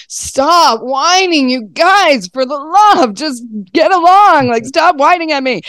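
high, whining complaint; whiny nagging. Retold or imitated. Mom whining at her whining kids, mock whiny. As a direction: whine back at the whining kids. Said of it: "I whine about their whining".

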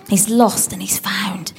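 slow hushed whisper. Shown as a demonstration. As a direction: breathe it out softly and slowly. Whispered verse echo.

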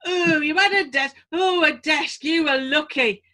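loud, gruff northern boast; hammed-up Yorkshire old-timer; Four Yorkshiremen. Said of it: "go into the Four Yorkshiremen".

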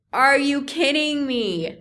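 high, slow, drawn-out disbelief; indignant, slow-motion incredulity; incredulous protest. Acted. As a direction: stretch it out, appalled at the offer. Indignant about only getting ice chips.

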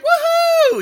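loud, very high whoop; kids cheering. Retold or imitated. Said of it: "We're like".

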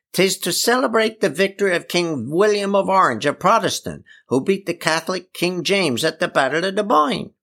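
high, declaiming dialect voice; Eli explaining. Acted. Eli telling ignorant villagers the answer, high and declaiming. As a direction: proclaim it high, in country dialect.

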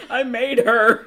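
loud, high, laughing confession; stammering guilty denials. Acted. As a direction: blurt it out, caught and flustered. Hal caught by Kilowog.

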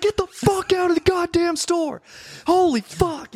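high-pitched frantic yell; reenacted panicked screaming order; retold freaking out. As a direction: shriek the order, acting out panic. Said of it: "I was, like, freaking out".

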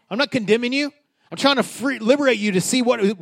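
ordinary talk, nothing marked

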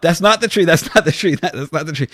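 high, loud, rapid-fire denial, easing off; insistent objection. Retold or imitated. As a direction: retell it as a frantic correction. Retold insistent objection to golfer.

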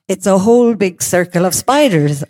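plain reading voice

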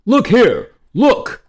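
high, loud royal shout; excited cry. A narrator's reading. King calling his wife to the chest, excited cry. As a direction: call out in astonished excitement. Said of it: "cried the king".

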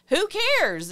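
high, loud who cares; emphatic. Real, not acted. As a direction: toss the question off with a flourish. Emphatic dismissal.

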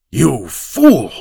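mild acted shout of rage; angry cry. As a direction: snap the insult at the boy. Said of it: "the old man cried".

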